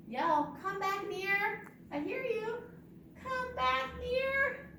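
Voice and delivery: high playful call; high-pitched beckoning. Pretend. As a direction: call the bird back in a high voice. Calling birds back in rhyme.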